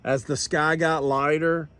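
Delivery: ordinary delivery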